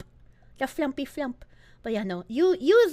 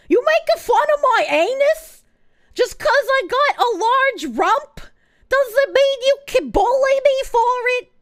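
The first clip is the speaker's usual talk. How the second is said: high, loud indignant complaint; playful affronted critter; mock offended; 7 semitones higher, 5 dB louder than usual. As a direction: act wounded at the teasing.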